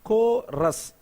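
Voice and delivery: "Chorus" in two distinct beats, "ko... rus"; split into syllables. Shown as a demonstration. Teacher demonstrates two-syllable words, "Cho-rus" beat by beat. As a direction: hold each syllable of "Chorus" apart. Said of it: "there are two syllable words".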